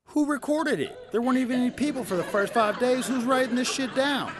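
high, loud disbelieving rant; incredulous outburst. Real, not acted. Comic ranting at the audience, high and loud. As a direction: demand to know who wrote it.

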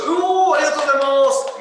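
high, loud shout of gratitude; big excited yell; roaring thanks. Real, not acted. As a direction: roar out a thank-you to a fan. Exclaims loud thanks for a viewer's comment.

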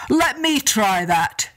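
slow, lightly voiced giant agreeing; a hint of a lumbering giant; giant's voice. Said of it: "Ben and Donner cried".

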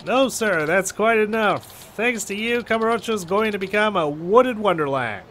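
lightly voiced, chirpy salesman; a hint of a polite street solicitor; eager petition collector. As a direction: pitch the forest like a keen canvasser. Voices the game's forestry petitioner.

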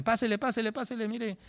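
high, eager market hawker call; shopkeeper calling customers. Retold or imitated. Teacher mimics an English shopkeeper, high hawker call. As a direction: lure shoppers in like a shopkeeper.

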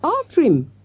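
high urgent cry; screamed. A narrator's reading. Girl urging classmates after the gunman, high scream. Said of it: "screamed Avril".

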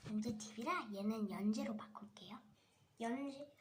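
soft unvoiced aside to the viewer; whisper aside. Real, not acted. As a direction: whisper it like a secret note. 역할놀이 중 옆에 속삭임. (살짝 속삭이는 혼잣말)

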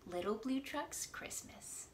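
usual speaking voice

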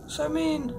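normal talking voice

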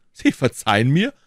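indignant exclamation, rising high; exclaimed question. A narrator's reading. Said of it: "rief D'Artagnan".

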